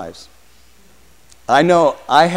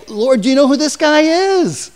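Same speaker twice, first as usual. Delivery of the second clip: high, alarmed protest, much higher pitch; alarmed Ananias; 9 semitones higher, 3 dB louder than usual. Acted. Ananias protesting to the Lord, high and alarmed. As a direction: plead in disbelief with the Lord. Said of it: "Ananias is saying".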